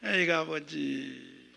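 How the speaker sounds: very slow, low, soft sigh; pitying lament. Real, not acted. Pastor to God about a pitiful people, sighed lament. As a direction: draw it out as a weary appeal.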